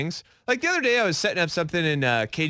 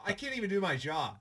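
much softer, off mic; far from mic; 3 semitones higher, 10 dB quieter than usual. Real, not acted. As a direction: talk away from the microphone. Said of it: "It sounds like you're far away".

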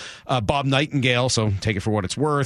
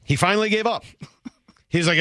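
ordinary talk, nothing marked

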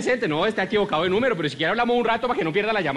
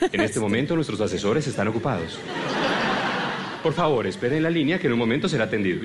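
phone menu voice; 8 semitones lower than usual. Retold or imitated. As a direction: lower recorded hold message. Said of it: "le contesta otra grabación diferente".

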